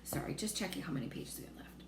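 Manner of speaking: quick unvoiced whisper; fast whispered side note to the listener; whispered aside. Real, not acted. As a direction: mutter it off-mic in a whisper. Reader's aside mid-story.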